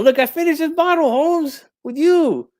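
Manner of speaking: high-pitched, wounded pleading; worked-up, high emotional protest. Pretend. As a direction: plead as if hurt by a friend. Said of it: "I'm emotional".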